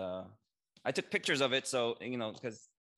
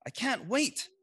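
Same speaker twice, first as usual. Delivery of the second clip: excited; 13 semitones higher, 5 dB louder than usual. A narrator's reading. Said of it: "Taylor said".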